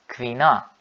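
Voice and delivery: "Quina" ending on a wide-open "ah"; open final A. Shown as a demonstration. Teacher showing learners the plural, "Quina" with open final A. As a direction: open up the last vowel of "Quina". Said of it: "Abrindo, né, a pronúncia".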